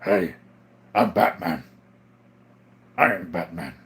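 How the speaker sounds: low, terse superhero voice; Batman. Acted. Livestreamer showing that little touch, low superhero impression. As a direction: drop it low for a caped vigilante. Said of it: "You have to give it that little, uh".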